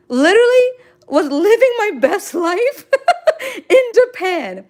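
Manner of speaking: high, gleeful, breaking into laughter; laughing delight. Real, not acted. Streamer recalls living her best life in Japan, laughing. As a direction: gush until the laugh takes over.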